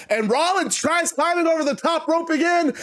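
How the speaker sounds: usual speaking voice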